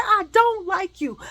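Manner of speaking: high, loud, drawn-out refusal; emphatic rejection. Retold or imitated. Woman rejecting the man bothering her, loud and emphatic. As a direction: push each word out slowly and loudly.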